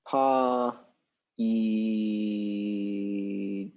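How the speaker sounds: "Pa ijed" stretched very slowly, the i held long; "Pa ijed" with a long sustained i; drawn-out sounding out. Real, not acted. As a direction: sound out "Pa ijed" while writing, holding the i. Sounding out while writing it.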